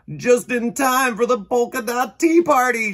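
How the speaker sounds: high, loud punchline shout; triumphant shout. A narrator's reading. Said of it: "shouted Jackie".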